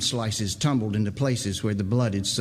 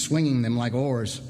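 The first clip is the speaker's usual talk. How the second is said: ordinary delivery, nothing marked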